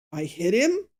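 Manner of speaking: astonished question, pitch swooping up at the end. Shown as a demonstration. Podcaster testing the emphasis of a reported quote, astonished. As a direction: say it in disbelief, rising sharply. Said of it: "like she's astonished".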